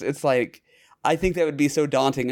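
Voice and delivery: normal talking voice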